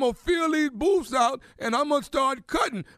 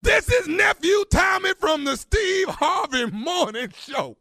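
big, higher announcer delivery; prank reveal announcement; 3 semitones higher, slower than usual. Acted. Host revealing the prank to the barber, triumphant announcer voice. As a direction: belt out the gotcha, drawing the words out.

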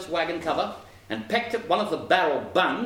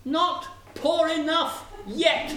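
high, slow sparrow taunt; 12 semitones higher, slower than usual. A narrator's reading. Sparrow taunting the ruined wagoner, high and slow. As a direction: draw it out high, word by word.

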